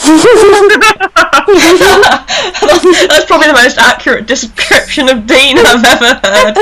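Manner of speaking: laughing through the words, breaking up; can barely get it out for laughing; laughing hard. Real, not acted. Host reading a viewer's joke aloud, laughing.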